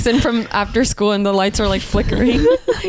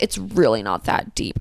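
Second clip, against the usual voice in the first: dismissive shrug; 10 semitones lower, 5 dB quieter than usual. Retold or imitated. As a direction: lower and softer, brushing it off. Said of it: "And she's like".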